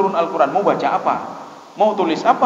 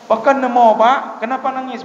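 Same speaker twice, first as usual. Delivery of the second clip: high, loud acted query; puzzled questioning; 7 semitones higher, 5 dB louder, slower than usual. Preacher retells asking the weeping man why, high puzzled voice. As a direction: ask as if baffled, pitched up.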